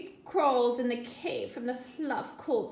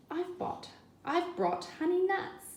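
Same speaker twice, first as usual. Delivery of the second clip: higher, eager little badger voice; grinning badger; 8 semitones higher than usual. Badger offering honey nuts to his friends, grinning. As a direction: offer the nuts with a grin in your voice. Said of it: "badger says with a grin".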